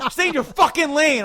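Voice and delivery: high, loud command; reenacted road rage shout; retold angry yell. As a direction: bawl out a driver. Retold argument with a driver.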